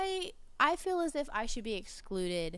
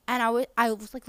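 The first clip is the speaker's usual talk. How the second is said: ordinary delivery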